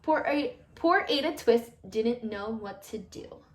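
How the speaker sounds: ordinary delivery, nothing marked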